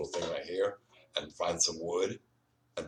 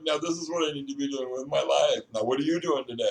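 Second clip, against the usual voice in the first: higher and louder, thrilled voice; excited quote; 9 semitones higher, 6 dB louder than usual. Retold or imitated. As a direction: relive the rush of joy. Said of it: "I was like".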